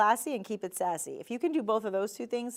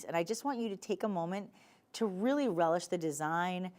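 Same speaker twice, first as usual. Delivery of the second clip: ordinary talk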